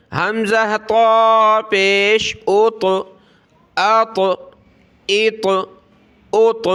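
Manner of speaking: تو as a heavy, rounded "taw"; full t with a small bounce closing ات; thick taw. Shown as a demonstration. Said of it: "اس کو ہم نے موٹا پڑھنا ہے".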